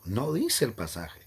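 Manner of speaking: usual speaking voice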